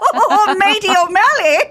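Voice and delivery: high laughing Irish brogue; Irish accent. Shown as a demonstration. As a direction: laugh through a leprechaun voice. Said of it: "that's good, O'Malley".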